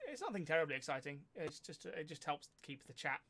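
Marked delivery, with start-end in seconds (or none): none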